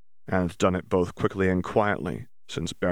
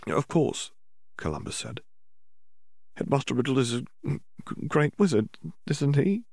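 put-on shaky hopeful girl; 7 semitones higher, 4 dB quieter than usual. Tearful girl seeks reassurance.

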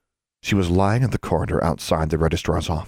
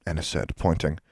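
usual speaking voice

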